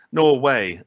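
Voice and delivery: loud, high northern English "Norway" punchline; northern English accent, retelling the joke. Retold or imitated. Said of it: "in a northern accent".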